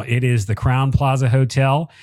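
ordinary talk

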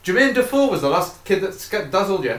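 normal talking voice

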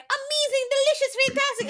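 very high, loud raving; mock-rave in an over-the-top squeal; gushing reviewer imitation.

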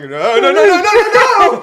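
loud high frantic repeated no; mock panicked no. Friend imitates a foreskin being roughly handled, loud high mock panic. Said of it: "make it sound like".

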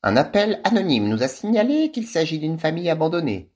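high, sly official voice; sly little woman. A narrator's reading. Social worker explaining the anonymous call, high and sly. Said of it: "dit la petite bouffie aux yeux fourbes".

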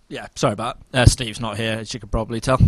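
ordinary talk

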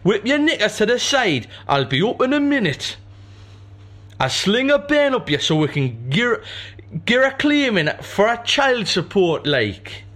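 loud, high put-on northern lad; northern bloke voice. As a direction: ham up a rough northern lad.

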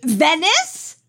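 normal talking voice

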